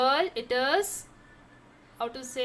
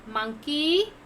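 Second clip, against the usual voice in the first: ordinary talk, nothing marked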